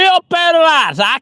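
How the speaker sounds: high, slow drawn-out cheer; excited cheer. Real, not acted. Hyped cheer to live viewers.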